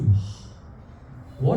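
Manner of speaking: breathy, low mock sigh of wonder; blissful sigh. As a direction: sigh it out like sinking into comfort. Voices maid touching soft bed.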